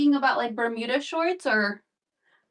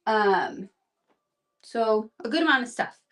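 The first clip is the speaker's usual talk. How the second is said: normal talking voice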